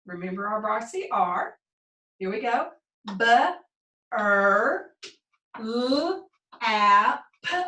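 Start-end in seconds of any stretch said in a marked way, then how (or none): 3.2-7.6 "B, er, l, a, p" one drawn-out sound at a time. Shown as a demonstration. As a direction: sound out each letter sound of burlap separately, with pauses. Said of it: "Let's read that word together".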